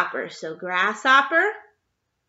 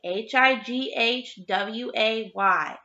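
letters spelt out slowly one by one; slow spelling; slower than usual. Shown as a demonstration. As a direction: spell it deliberately for young pupils. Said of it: "spelling the word out slowly".

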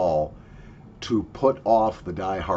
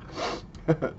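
breathy laugh; 7 semitones higher, 6 dB quieter than usual. Real, not acted. Laughing after a coughing fit.

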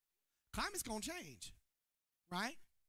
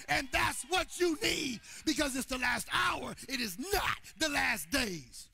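loud, rising shouted sermon, higher and louder; preaching at full force; 4 semitones higher, 9 dB louder than usual. Acted. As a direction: preach it to the back row, building to a roar.

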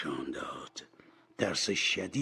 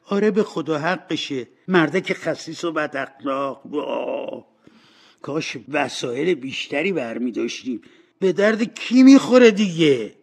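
raised scornful voice, rising to a loud finish; contemptuous cleaning woman; 6 semitones higher, 9 dB louder than usual. Acted. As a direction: curse the old miser, then push higher and louder.

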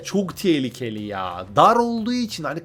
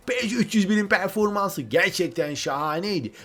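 ordinary talk, nothing marked

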